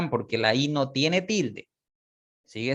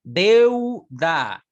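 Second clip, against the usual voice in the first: Deuda said louder with a strong E; model word; 4 semitones higher, 7 dB louder than usual. Shown as a demonstration. Teacher modelling open vowel, emphatic. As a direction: hit the E in Deuda. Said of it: "la E se abre más".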